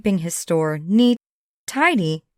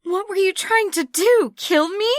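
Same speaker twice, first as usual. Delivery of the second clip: shouting; 13 semitones higher than usual. Acted. Sam accuses Abigail, gun shaking, shouting. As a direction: throw the question at her like a charge. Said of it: "Sam shouted".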